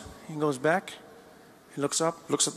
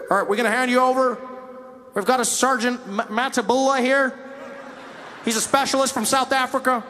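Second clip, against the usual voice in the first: a hint of a police officer calling out; lightly voiced cop; cop on megaphone; 7 semitones higher, 4 dB louder than usual. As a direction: address the suspect like a cop.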